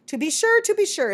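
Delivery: high, loud, effusive assent; breezy agreement. A narrator's reading. Mockingbird answers the bat about his poem, high and breezy. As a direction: agree airily, pitched right up.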